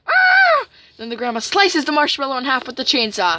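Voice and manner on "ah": very high, loud mock shriek; cartoon scream of fright; high-pitched fleeing yell; scared scream. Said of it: "runs away scared".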